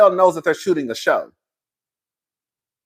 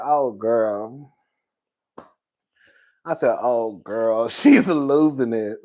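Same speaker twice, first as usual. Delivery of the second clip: low drawl; 8 semitones lower, slower than usual. Retold or imitated.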